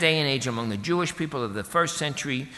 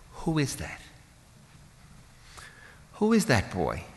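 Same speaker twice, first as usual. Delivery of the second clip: wondering mother. Acted. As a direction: wonder aloud about your own child, lightly acted. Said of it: "She's gotta be wondering".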